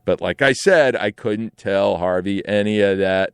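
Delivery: usual speaking voice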